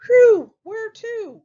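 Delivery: high, loud, drawn-out piglet; slow high pig voice after a loud whew; first little pig. Acted. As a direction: ask loudly as a high-pitched little pig. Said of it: "The first pig says".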